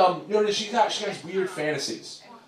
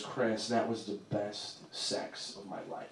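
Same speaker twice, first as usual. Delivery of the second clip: soft morning voice; 6 semitones lower, 14 dB quieter, slower than usual. Retold or imitated. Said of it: "she goes".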